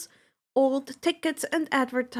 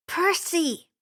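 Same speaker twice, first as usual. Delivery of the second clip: put-on higher girl's voice, a sharp warning; warning Annabeth; 6 semitones higher than usual. A narrator's reading. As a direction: Annabeth scolding Percy. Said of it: "Annabeth warned".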